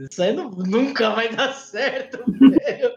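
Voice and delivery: laughing retort; laughing while retelling. Retold or imitated. Retelling reply to teacher, laughing.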